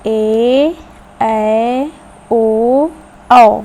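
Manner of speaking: put-on stretched vowel sounds for children; long vowel demo. Shown as a demonstration. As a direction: sound out each long vowel clearly, building in volume. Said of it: "जैसे".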